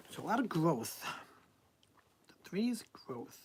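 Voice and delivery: whispered aside. Real, not acted. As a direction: breathe it softly to yourself.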